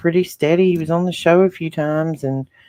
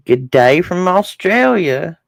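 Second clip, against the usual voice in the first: slow, higher-pitched Australian greeting; Australian accent; 4 semitones higher, 4 dB louder, slower than usual. Acted. Host greets an Australian listener in the chat, playful Australian accent. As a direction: put on a drawn-out Australian accent. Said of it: "I love Australian accents".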